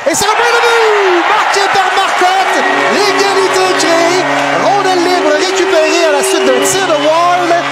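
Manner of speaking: excited sports commentary. Real, not acted. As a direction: yell the tying goal. Tying goal called.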